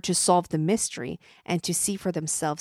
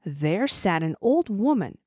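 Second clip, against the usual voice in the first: usual speaking voice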